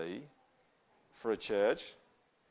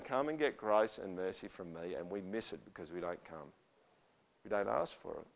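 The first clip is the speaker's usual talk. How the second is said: soft call; 4 semitones lower, 6 dB quieter than usual. Acted. Voicing God softly, then lament.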